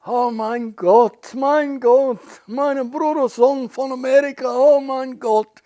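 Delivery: high, loud, slow cries of joy in German; an aunt overjoyed to meet her brother's son; ecstatic. Retold or imitated. Aunt greeting her nephew from America, ecstatic, high and loud. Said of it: "she's ecstatic".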